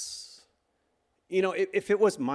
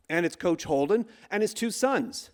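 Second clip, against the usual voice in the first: ordinary talk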